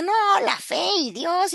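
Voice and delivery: mock dismissive. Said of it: "y yo decía".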